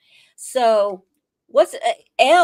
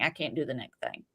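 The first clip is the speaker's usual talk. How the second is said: hushed pleading; 10 semitones lower, 12 dB quieter, faster than usual. Retold or imitated. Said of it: "she does a little".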